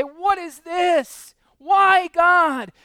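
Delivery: high, slow, loud cry of why; anguished cry to God. Pretend. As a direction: cry out to heaven in pain. Voicing anguished believer crying out.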